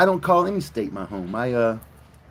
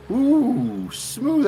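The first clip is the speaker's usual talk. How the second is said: mock swoon; 10 semitones higher, 3 dB louder than usual. Reacting to accent compliment.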